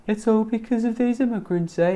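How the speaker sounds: high-pitched imitation of a comedy British accent. Coach echoing the guy's video, comedy British accent. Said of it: "a very comedy British accent".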